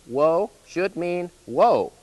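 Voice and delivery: ordinary talk, nothing marked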